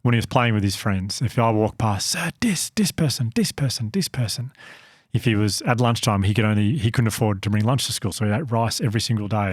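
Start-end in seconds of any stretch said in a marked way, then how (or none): 2.1-4.5 "dis" with d, higher, falling off; eager boy's "dis person"; imitating the boy. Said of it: "he would say dis".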